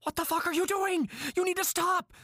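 high, frantic panicked yelling; shrill panic, voice shooting up; panicked shout. Acted. Captor panics as she screams.

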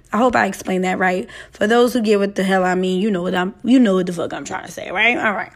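ordinary talk

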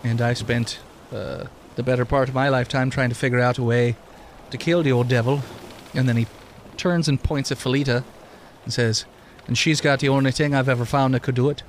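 put-on mildly Irish-tinged lilt for an old immortal NPC; Wisconsin Irish accent. GM voicing the immortal Tom to the players, light Irish brogue. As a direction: keep a light Irish brogue, wry and storytelling. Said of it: "He sounded like he was a Wisconsin Irishman".

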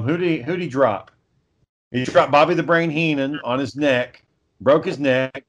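ordinary talk

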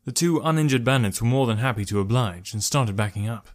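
ordinary delivery, nothing marked

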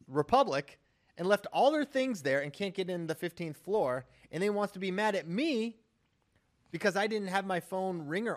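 loud teasing rant; playful loud scolding of a friend; mock outrage. Real, not acted. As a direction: really lay into him, loudly. Heated ribbing of Ollie.

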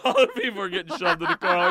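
ordinary talk

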